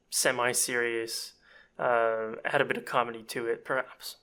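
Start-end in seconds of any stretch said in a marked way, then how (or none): none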